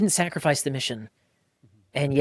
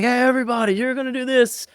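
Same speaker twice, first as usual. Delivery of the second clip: drill instructor; 10 semitones higher than usual. Retold or imitated. Fire academy instructor calling out.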